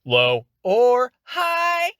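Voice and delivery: slow jump from low to high; low, then high. Shown as a demonstration. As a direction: start low, then leap up high and loud. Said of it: "you've got your pitch low or high".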